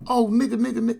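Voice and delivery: high, loud, careless repetition; rolled off the tongue. Retold or imitated. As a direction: imitate someone tossing the word around freely. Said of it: "it just rolled off his tongue".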